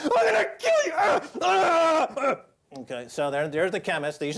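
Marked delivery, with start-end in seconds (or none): 0.0-2.4 hammed-up murderous banker yelling; mock thug screaming threats. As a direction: shriek the threats like a crazed mobster. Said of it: "my impression of R B S doing business".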